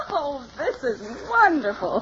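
high, laughing delight; delighted laughter. Acted. Client hearing her hairdresser's secret, delighted laughter. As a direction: burst out laughing, then exclaim.

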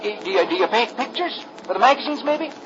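put-on a young lad eager to ask questions; eager boy. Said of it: "Rusty is saying".

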